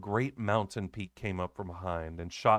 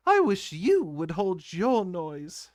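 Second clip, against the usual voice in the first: high, slow, louder scolding; rude retort; 8 semitones higher, 5 dB louder, slower than usual. A narrator's reading. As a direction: snap at the singing boy. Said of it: "said the nurse rudely".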